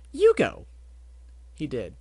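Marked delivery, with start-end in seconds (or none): 0.1-0.7 eager prompt. Acted. Pinkie urges Barry to speak.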